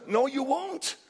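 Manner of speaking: ordinary talk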